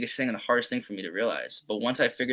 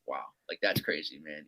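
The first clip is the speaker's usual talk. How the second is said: low, much softer amazed murmur; hushed wonder; 8 semitones lower, 11 dB quieter than usual. Retold or imitated. Player amazed at his own hitting, hushed. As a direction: marvel quietly to yourself.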